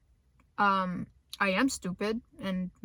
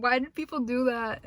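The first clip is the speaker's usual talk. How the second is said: ordinary delivery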